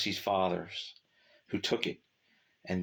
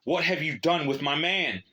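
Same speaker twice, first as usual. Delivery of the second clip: loud, high, menacing shout; threatening yell; 9 semitones higher, 6 dB louder than usual. Acted. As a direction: bark it as a threat. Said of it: "yelled in a threatening tone".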